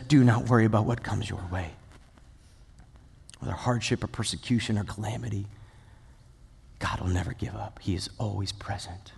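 very soft, low-pitched reassurance; hushed, comforting scripture reading; gentle plea. Acted. Preacher pleading with listeners not to worry, gentle and hushed. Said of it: "make this beautiful plea and says".

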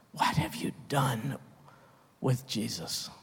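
soft, probing question; hushed question. Real, not acted. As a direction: drop to a near hush to confront them.

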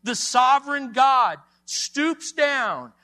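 high, loud, emphatic sermon delivery; full-force preaching. Real, not acted. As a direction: proclaim it to the congregation with rising force.

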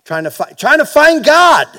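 high, loud cry of a seeker chasing God; mock seeker's cry. Pastor mocking a pilgrim to the congregation, high loud cry. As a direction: belt it out like a desperate pilgrim. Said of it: "He goes".

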